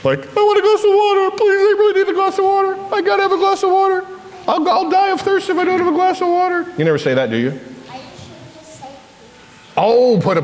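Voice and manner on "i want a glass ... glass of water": put-on very high, whiny begging kid voice; grumbling child. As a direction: whine and plead like a thirsty child at bedtime. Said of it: "be tempted to grumble".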